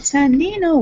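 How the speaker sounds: high, loud yelled name; retold yell. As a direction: imitate someone yelling out a name. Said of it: "she yelled out loud".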